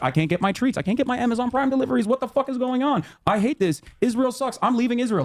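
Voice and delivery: high, fast mocking whine of a spoiled complainer; hammed-up whining about missing treats and deliveries; mocking whiny Israeli. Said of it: "They're gonna be like".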